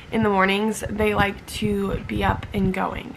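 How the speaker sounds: ordinary talk, nothing marked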